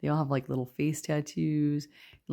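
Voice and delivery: normal talking voice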